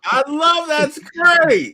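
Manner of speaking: high, loud delighted cry. Real, not acted. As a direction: squeal with real delight. Laughing reaction to impression.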